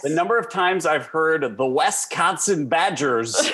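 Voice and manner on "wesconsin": "Wisconsin" with "Wes" at the front; wrong pronunciation. Retold or imitated. Imitating what is heard about the Badgers, "Wes-consin". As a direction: say "Wisconsin" as "WES-kahn-sin". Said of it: "Do you see an E in there?".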